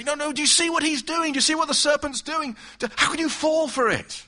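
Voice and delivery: high, loud urgent plea; pleading. Retold or imitated. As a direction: cry out to warn her. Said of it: "we'd wanna plead with her and say".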